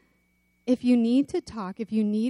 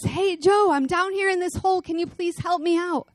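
higher, quicker call for help; 7 semitones higher than usual. Retold or imitated. Man in a hole calling up to his friend Joe. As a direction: plead upward from the pit. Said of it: "looks up and says".

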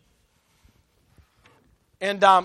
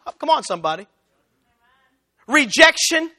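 ordinary delivery

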